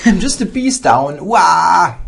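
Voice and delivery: loud laughing wail; laughing outcry. Real, not acted. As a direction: laugh and cry out at the blunder. Laughing at own piece loss.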